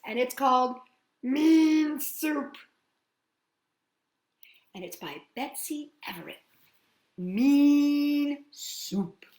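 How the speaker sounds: ordinary delivery, nothing marked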